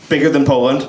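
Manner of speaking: ordinary talk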